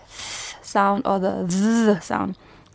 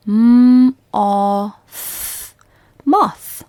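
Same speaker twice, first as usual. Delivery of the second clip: loud sounding out; 6 dB louder than usual. Shown as a demonstration. Teacher repeating moth louder for pupils. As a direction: call out M-o-th then Moth firmly. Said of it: "One more time".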